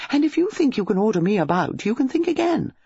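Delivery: high, rising female retort; defiant. Acted. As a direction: fling the challenge back indignantly. Acted lovers' quarrel.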